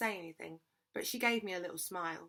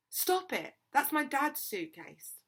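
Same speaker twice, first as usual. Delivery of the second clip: sharp, higher-pitched protest; 6 semitones higher, 4 dB louder, slower than usual. A narrator's reading. Girl telling others to stop kicking her dad's suitcase, sharp. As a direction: tell them off sharply, pitch jumping on the first words. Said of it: "I said sharply".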